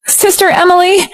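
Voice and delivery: high call to someone far off. A narrator's reading. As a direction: call her name across the house. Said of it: "April suddenly shouted".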